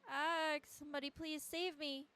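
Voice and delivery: high, slow cry for help; drawn-out, pleading cry; acted wailing plea. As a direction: wail for someone to save you. Acts child begging for rescue.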